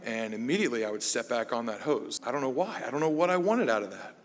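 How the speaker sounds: ordinary talk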